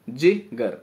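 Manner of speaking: जिगर very slow, as "ji", pause, "gar"; two separate beats in जिगर, gap after ji; split into syllables. Shown as a demonstration. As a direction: break each जिगर into short ji, then gar. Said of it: "तो एक, दो".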